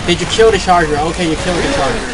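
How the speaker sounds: normal talking voice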